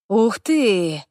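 drawn-out, mildly awed exclamation; delighted wonder. A narrator's reading. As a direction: marvel like a delighted little elf. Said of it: "с восторгом сказал эльфик". (с лёгким восторгом)

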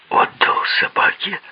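high, hushed disbelieving question; incredulous echo. A narrator's reading. Narrator grabbing Bogl's arm after the news, incredulous. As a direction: ask it back in shocked half-whisper. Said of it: "переспросил я".

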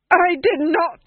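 high, loud scream of denial; screaming duke. Acted. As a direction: scream it in panic. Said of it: "screamed the Duke".

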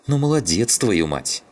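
high, sharp sarcastic snap; snapping back. A narrator's reading. Shum snaps at Zhigan for waking the dog, sarcastic snap. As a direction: bite back at him with scorn. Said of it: "огрызнулся Шум".

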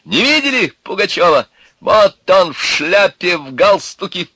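high, loud mocking proclamation; free, defiant yelling; shouting. A narrator's reading. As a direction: holler to gawkers like a street crier. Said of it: "кричал он".

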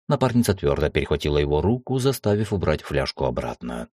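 ordinary delivery, nothing marked

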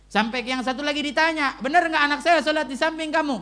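high, loud sermon delivery; forceful preaching. Acted. Preacher retells a father questioning a neighbour, loud and insistent. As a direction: project the retold question to the congregation.

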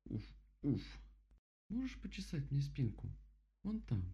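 straining, pleading bear voice; itchy bear. Acted. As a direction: strain reaching an itch. Itchy bear asks for scratch.

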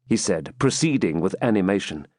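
plain reading voice

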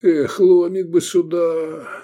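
high, drawn-out lament; wistful, stretched-out moan; sighing regret. A narrator's reading. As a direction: sigh it out slowly and wistfully. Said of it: "завздыхал Исмат".

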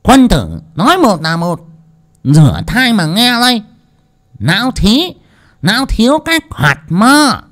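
high, loud, triumphant; gleeful boast. A narrator's reading. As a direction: slam the table with glee. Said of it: "khoái chí đập bàn".

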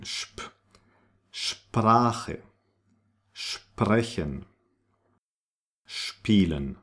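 Sprache, sprechen, spielen begun with shp; bare "shp", then each word slowly spaced. Shown as a demonstration. Teacher drills German SP, sh before the p. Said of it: "S P combination is pronounced like".

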